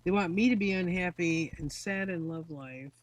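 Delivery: ordinary delivery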